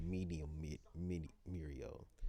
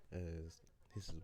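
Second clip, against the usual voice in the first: usual speaking voice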